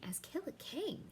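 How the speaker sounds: breathless high whisper of disbelief; queen stunned by the accusation, whispering; shocked whisper. Acted. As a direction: barely get the words out, stunned. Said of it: "She's shocked by this".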